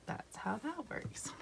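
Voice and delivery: soft whispered aside. Real, not acted. Finishing a drawing step aside.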